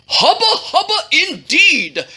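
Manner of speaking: high, hammed-up royal ogling; king's retort. A narrator's reading. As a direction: exclaim it as a smitten cartoon king. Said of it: "the king retorted".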